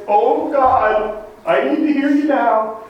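high-pitched mock-panicked prayer; desperate plea. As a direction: cry out to God as if crisis just hit. Acting out panicked prayer in crisis.

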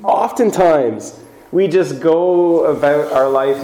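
normal talking voice

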